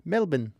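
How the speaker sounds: Melbourne said as "MEL-bun"; Melbourne, local way. Shown as a demonstration. Host drilling the Australian pronunciation of Melbourne. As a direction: swallow the end of Melbourne to "bun". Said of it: "Think of it as M E L B I N".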